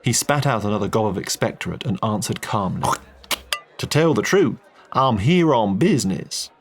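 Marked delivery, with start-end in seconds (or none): 3.8-6.5 easy Texan oil man, friendly and blunt; a folksy Texan businessman chatting; Texan drawl. Acted. Texan answers calmly.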